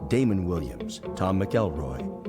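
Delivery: ordinary talk, nothing marked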